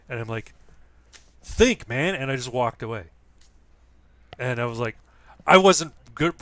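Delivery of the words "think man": a sharp, louder, higher retort; retold snap. Bully who just apologized, given a sharp mock scolding. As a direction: bite it off, mildly mock angry. Snapping back after the apology.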